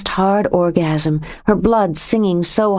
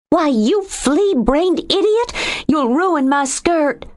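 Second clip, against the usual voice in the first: pitched way up, slow and outraged; a woman tackled in the surf, shrieking at him; high indignant shriek; 10 semitones higher, slower than usual. A narrator's reading. Furious high-pitched protest.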